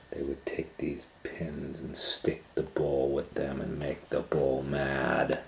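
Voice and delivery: hushed, breathless storytelling whisper; secret whisper. A narrator's reading. Reading to children at bedtime, whispering the scary part.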